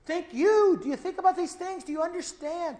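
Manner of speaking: loud and high, pressing the question home; emphatic preaching. Real, not acted. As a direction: hammer each question at the congregation. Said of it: "he's emphasizing them".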